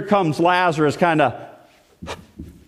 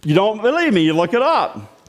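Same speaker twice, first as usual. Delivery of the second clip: ordinary talk, nothing marked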